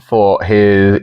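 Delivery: ordinary delivery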